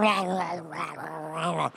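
low gibbering that fades away; faked madness. David slobbering down his beard, feigning madness with slurred growls. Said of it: "He faked madness".

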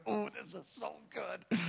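soft, slow, blissful sigh; hushed, tired delight; weary but happy. Retold or imitated. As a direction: savour it under your breath. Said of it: "weary but happy".

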